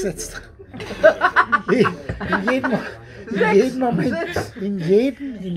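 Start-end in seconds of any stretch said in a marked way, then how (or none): 0.4-1.7 high bursting laugh; laughing outburst. Real, not acted. Laughs before repeating phrase. 1.7-4.5 slow, higher, through laughter; laughing. Real, not acted. Repeats phrase while laughing.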